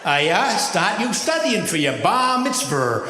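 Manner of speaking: put-on high, loud old grandfather with a Maine drawl; grandfather's broad Maine accent, high and loud.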